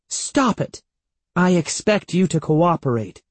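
high-pitched, cross order; firm parental telling-off; angry scolding. A narrator's reading. Mother ordering her son to behave, angry. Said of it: "Missus Ross shouted angrily".

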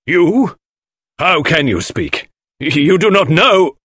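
high, loud, slow protest; agitated outburst. A narrator's reading. Husband springs up to stop Poirot, agitated. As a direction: burst out alarmed, then break off. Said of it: "he broke off abruptly".

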